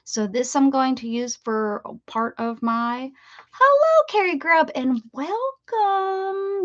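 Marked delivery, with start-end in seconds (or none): 3.5-6.6 high, loud drawn-out hello to a new viewer; sing-song greeting. Real, not acted. Greeting a first-time viewer on her live stream, high sing-song hello. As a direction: stretch the welcome out slow and bright.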